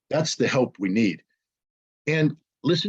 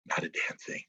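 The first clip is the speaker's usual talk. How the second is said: hushed punchline; 14 dB quieter than usual. Real, not acted. Answering his own question, he whispers the punchline. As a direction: drop to a low unvoiced whisper on "Not a damn thing".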